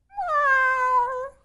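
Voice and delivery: very high, squeaky kitten meow; kittens' meow. Acted. Kittens answer their mother with a high meow. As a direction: mew like three tiny kittens. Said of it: "cried the three".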